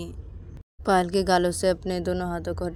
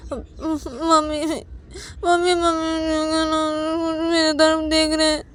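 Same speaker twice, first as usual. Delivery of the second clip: higher, louder, slow tearful wail; crying child; 11 semitones higher, 7 dB louder, much slower than usual. Acted. Payal to mother, crying and complaining. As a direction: whimper for mum through tears. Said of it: "पायल खाना खाते खाते अनन्या की mummy को बोलती कि".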